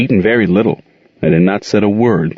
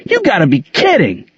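shouted; 13 semitones higher than usual. A narrator's reading. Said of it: "he shouted".

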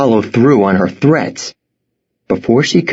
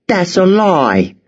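slow, indignant cry; girl crying out that the boy is lying; angry cry; 9 semitones higher, slower than usual. A narrator's reading. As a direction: throw the accusation back at him. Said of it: "she cried".

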